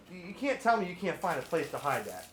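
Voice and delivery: ordinary talk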